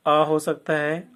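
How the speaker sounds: अ said as a short open uh; teacher sounding out अ. Shown as a demonstration. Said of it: "ए का उच्चारण क्या क्या हो सकता है".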